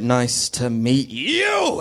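very slow, drawn-out villain greeting; hammed-up sinister greeting; Bond villain. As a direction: stretch each word, soaring on the last.

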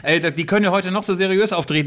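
ordinary talk, nothing marked

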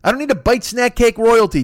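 normal talking voice

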